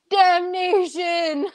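drawn-out, high-pitched theatrical cry; hammed-up villain's cry, stretched out slowly; dramatic mock shout. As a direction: wail it melodramatically, high and long, in jest. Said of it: "he shouts".

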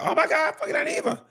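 mock fangirl squeal, very high with a laugh; squealing fans. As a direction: shriek it like starstruck fans. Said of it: "They're like".

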